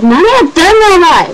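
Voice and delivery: loud, high, drawn-out outraged shout; a stunned, furious yell of Japanese; slow shocked yell. Retold or imitated. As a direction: bellow it at the groper in disbelief. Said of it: "and I'm like".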